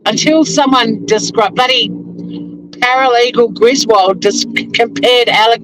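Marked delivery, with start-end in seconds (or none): none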